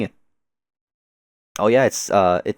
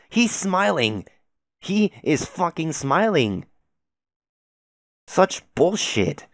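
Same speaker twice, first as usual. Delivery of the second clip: high, drawn-out disbelief; incredulous outrage; 6 semitones higher, slower than usual. Real, not acted. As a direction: protest it slowly, pitch up high. Reader outraged at what is shown.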